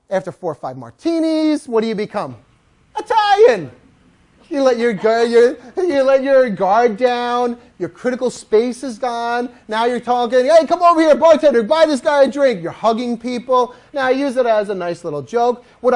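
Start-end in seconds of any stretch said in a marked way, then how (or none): 3.0-3.7 a high, loud punchline; punchline shout. Acted. Trainer shouting the joke's punchline to the crowd. Punchline about tipsy Irish. 10.5-12.6 loud and high, calling to the bar; a merry drunk calling the bartender over; tipsy calling out. Acted. Tipsy guest calling loudly for the bartender.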